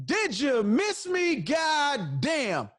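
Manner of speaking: high, slow drawn-out gloat; triumphant catchphrase. Retold or imitated. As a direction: savor the punchline like a show tagline. Confronting Buck, podcast catchphrase.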